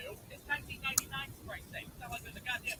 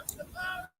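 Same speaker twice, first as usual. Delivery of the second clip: exorcist. Retold or imitated. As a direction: chant it like a movie exorcist. Exorcist line at possessed friend.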